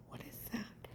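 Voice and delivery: very soft, near-whispered question; retold in a hushed whisper; hushed alarm. Woman hearing footsteps in her house, hushed. Hears footsteps while on phone.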